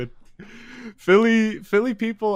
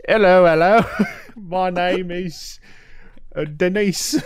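high, loud put-on British greeting; mock British girly voice; 8 semitones higher, 5 dB louder, slower than usual. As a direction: do a cutesy British accent introduction. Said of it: "like, British".

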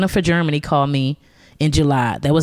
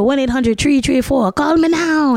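Cleo; 8 semitones higher than usual. Retold or imitated. Comic mimicking a psychic's commercial, Jamaican accent. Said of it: "of this Jamaican psychic lady named Cleo".

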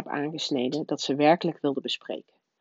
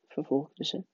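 plain reading voice

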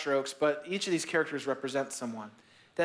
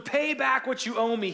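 a mild mock demand, higher and pushy; 7 semitones higher than usual. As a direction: servant chokes coworker demanding payment, mild threat. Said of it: "he demanded".

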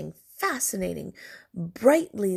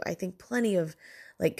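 ordinary talk

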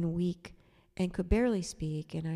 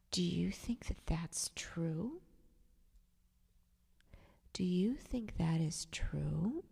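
slow, hushed, skeptical question; doubtful whisper; 5 dB quieter, slower than usual. Retold or imitated. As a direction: gently cast doubt in a whisper. Doubtful question to fragile woman.

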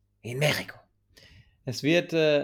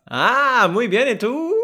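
much higher, cheery Spanish reply; sing-song muy bien, ¿y tú? in Spanish; high mock Spanish; 9 semitones higher, 7 dB louder, slower than usual.

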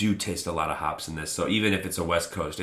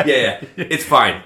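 louder, laughing it off; 4 semitones higher, 7 dB louder, slower than usual. Real, not acted. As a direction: laugh through the words.

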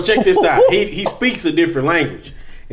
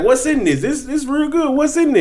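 curious king; 7 semitones higher than usual. Retold or imitated. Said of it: "He was like".

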